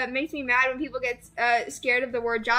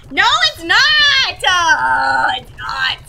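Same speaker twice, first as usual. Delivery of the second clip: mock anguish; 4 semitones higher, 9 dB louder, much slower than usual. Anguish over killed character.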